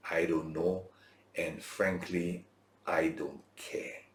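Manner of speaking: slow, breathy whisper of indifference; whispered shrug. Real, not acted. Preacher shrugging off forgotten famous men, in a slow whisper. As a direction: let it fall away to a whisper, slowly.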